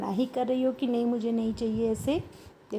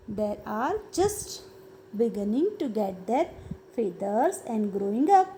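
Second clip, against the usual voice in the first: slow reading; 3 semitones lower, much slower than usual. Shown as a demonstration. Teacher reads slowly to kids.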